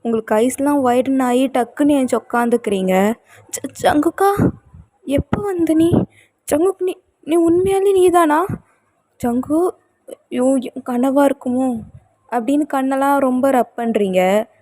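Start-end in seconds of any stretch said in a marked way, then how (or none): 3.5-11.8 can't believe he's really here; halting, astonished high voice; stunned disbelief. Acted. Wife stunned husband is back.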